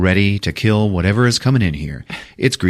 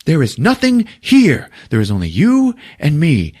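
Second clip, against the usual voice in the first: high, loud mock snap; mock angry; 11 semitones higher, 3 dB louder than usual. Podcaster voicing a character telling Treat off, mock anger. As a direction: shout him down, half-hammed. Retelling movie, character snapping back.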